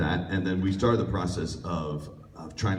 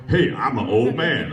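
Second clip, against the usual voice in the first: loud, higher put-on old man; 7 semitones higher, 7 dB louder than usual. Auditioner showing off his range, loud old man voice. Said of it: "have you heard my old man voice?".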